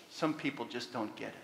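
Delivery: low, soft punchline; quiet caption read. Retold or imitated. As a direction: let the caption drop quietly. Reading a cartoon caption.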